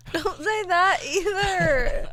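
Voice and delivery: said through laughter, slow and loud; laughing while scolding a friend; laughing protest. Real, not acted.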